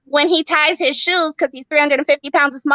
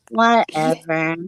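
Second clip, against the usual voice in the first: low slow whatever; 7 semitones lower, slower than usual. Real, not acted. Repeated dismissive aside.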